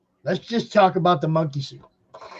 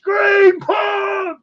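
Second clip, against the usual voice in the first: yelling across the room. Pretend. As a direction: yell the name across a crowded hall. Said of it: "I'll be yelling it from across the room".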